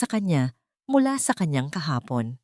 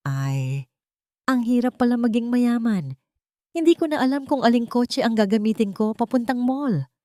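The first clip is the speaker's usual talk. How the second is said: higher, mock-weary showing off; sarcastic jab; 6 semitones higher than usual. A narrator's reading. Leticia showing off to her neighbours, a sarcastic humblebrag. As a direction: sigh over being rich just to rub it in. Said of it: "Ang kanyang mga salita ay patutsada".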